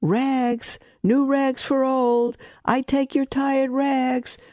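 high, slow, sung-out ragman's cry; street vendor's call. Acted. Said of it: "he was calling in a clear voice".

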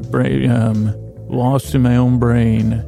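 ordinary talk, nothing marked

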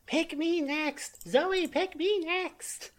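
high, drawn-out character begging; slow, high-pitched plea to be chosen; eager pleading. Acted. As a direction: sing out each word up high, begging. Player voices character begging turn.

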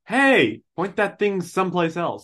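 loud, high indignant call; Han. Acted. Smuggler warning off Ewoks with a spear, loud and high. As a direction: call out sharply to back someone off. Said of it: "that was Han to the Ewoks".

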